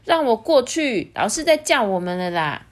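bossy princess. Acted. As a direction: shoo the parents off impatiently. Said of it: "艾莉诺就说".

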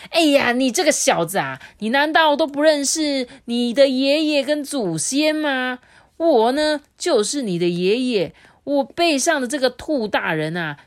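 high, loud lecturing tone; haughty deer father. A narrator's reading. Deer father posing as the tiger's grandfather, high loud scolding. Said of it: "鹿爸爸继续说".

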